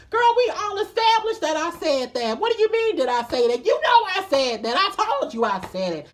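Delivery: high, loud, fed-up comeback; exasperated retort. Retold or imitated. Telling the boys he already admitted it, high and fed up. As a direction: throw it back at them, exasperated.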